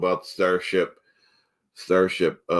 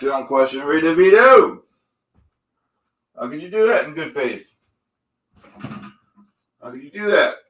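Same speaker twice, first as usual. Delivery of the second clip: higher, puffed-up demand for respect; pompous indignation; 6 semitones higher, 4 dB louder than usual. Acted. Host warning viewers not to question him, pompous. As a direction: lean in, offended host.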